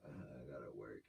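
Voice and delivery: low, soft reluctant groan; reluctant sloth. Retold or imitated. Artist admitting laziness when work calls, reluctant. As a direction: grumble it low and quietly, like a sloth. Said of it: "I'm a, I'm a sloth".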